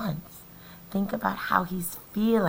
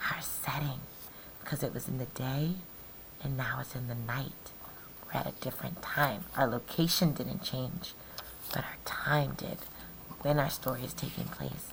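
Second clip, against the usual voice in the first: soft aside; 5 semitones lower, 8 dB quieter, faster than usual. Real, not acted. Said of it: "teacher explaining softly".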